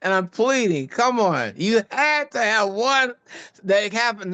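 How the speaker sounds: high, urgent pleading; retold plea, pitched well up. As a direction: beg him to admit it. Pleading with friend to admit racism.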